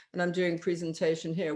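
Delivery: ordinary talk, nothing marked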